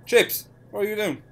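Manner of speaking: loud, high-pitched exasperated call; mock scolding. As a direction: tell off a slacking worker, play-acting the boss. Player scolds a co-worker.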